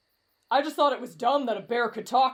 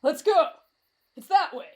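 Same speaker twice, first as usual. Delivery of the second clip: eager call; 5 semitones higher, 3 dB louder than usual. Acted. Eager leader calling the group onward.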